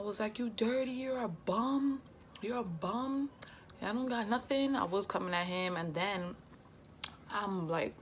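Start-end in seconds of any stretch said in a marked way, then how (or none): 0.4-4.8 cursing him out. Retold or imitated. Calling her baby father a bum, high and scornful. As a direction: drag out the word bum with scorn. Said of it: "I was cursing him out".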